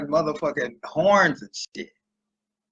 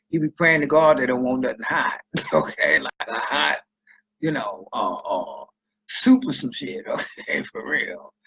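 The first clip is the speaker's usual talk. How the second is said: usual speaking voice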